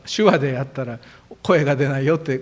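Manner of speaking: ordinary talk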